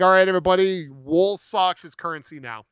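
a hammed-up conqueror decree; mock world ruler. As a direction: announce the new currency grandly. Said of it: "I can literally be like".

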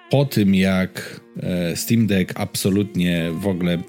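ordinary delivery

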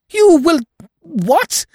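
can't believe what she just heard; astonished. Acted. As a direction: Eve shocked by the uncle's confession. Eve astonished at the theft.

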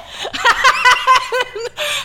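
high, rising burst of laughter; laughing at the memory; real laugh. Real, not acted. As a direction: crack up. Laughing after retort.

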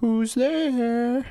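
put-on very high, drawn-out old granny call; grandmother voice. Grandma answering a knock at her door, high granny voice.

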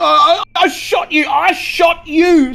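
high panicked stammer, loud. Acted. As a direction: blurt it out in terror.

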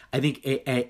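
ordinary delivery

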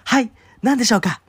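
imitating a loud, high-pitched waiter; high, booming customer-service reply. Waiter answering a discreet customer, loud and high. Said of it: "めっちゃ大きな声で言ったわけよ".

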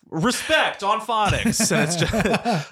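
high, slow sales-pitch voice; infomercial pitch. Pretend. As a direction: hype it like a late-night ad.

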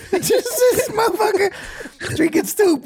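high, teasing put-on voice; high mocking voice. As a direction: squeak it out like a jeering friend. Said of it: "and he's like".

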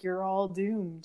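slow, soft, ominous prophet of doom; creepy doom voice. Retold or imitated. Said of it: "the creepy guy that's like".

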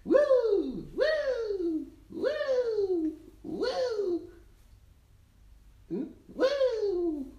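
high, loud playful woo; excited cheering. Real, not acted. Cheering while bouncing baby.

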